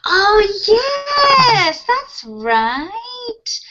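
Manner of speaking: high, stretched-out "oh, yes"; surprised realization. Real, not acted. Teacher reacting to student's question about the list, surprised. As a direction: start loud and high, rising on "right".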